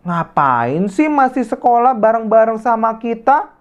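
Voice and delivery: high, scornful schoolyard taunt; sneering bully girl. Acted. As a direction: look down on her and mock. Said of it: "Rani berbicara seperti ini kepada Dea". (ejekan sinis anak sombong)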